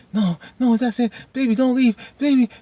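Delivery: high, whimpering pretend begging; mock pleading. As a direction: beg baby to stay, hammed-up. Acts a partner begging.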